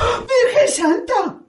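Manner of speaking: high, slow, half-laughing sob; landlady crying out on hearing he goes to war; shocked cry. Acted. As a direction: gasp it out in dismay.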